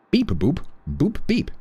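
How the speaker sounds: high chirpy robot beeps; toy robot voice. Pretend. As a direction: play a cheerful toy robot. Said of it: "I am a T T S".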